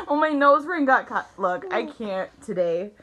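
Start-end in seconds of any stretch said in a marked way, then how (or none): 0.0-1.3 laughing again. Real, not acted. Laughing fit.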